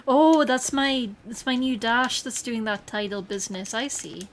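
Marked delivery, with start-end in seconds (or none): 0.1-2.2 loud, slow excited oh; drawn-out delighted exclaim. Real, not acted. Excited discovery.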